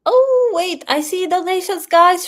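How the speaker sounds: sudden high surprised oh. Real, not acted. Streamer spots new donations, real surprise. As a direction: caught off guard, voice jumping up.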